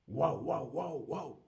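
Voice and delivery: playful mock barking; dog bark. Shown as a demonstration. Teacher imitating a dog for the class. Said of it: "El perro".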